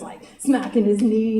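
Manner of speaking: usual speaking voice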